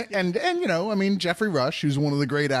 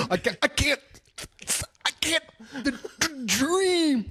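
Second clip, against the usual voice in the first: mock stammer; 10 semitones higher than usual. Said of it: "King and his speech therapist".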